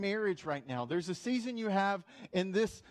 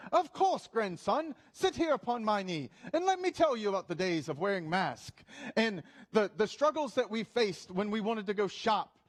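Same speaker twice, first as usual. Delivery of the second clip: put-on British accent; 2 semitones higher than usual. Granddad telling his grandson of pandemic hardships, British accent. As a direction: reminisce theatrically in a mock British accent. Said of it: "I'm gonna have some British accent".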